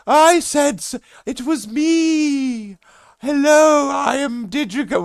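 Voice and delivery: high, slow, drawn-out put-on greeting; odd stranger voice. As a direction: stretch every word, high and strange. Stranger insisting it was them.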